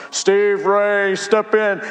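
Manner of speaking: God summoning him into the judgment hall, solemn. Pretend. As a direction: call him forward like a judge on the last day. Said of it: "and he said".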